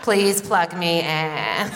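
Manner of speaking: slow, creaky vocal fry. Retold or imitated. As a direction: drag it out in fry like a dying battery. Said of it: "They call it the vocal fry".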